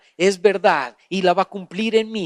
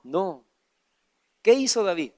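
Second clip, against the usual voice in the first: usual speaking voice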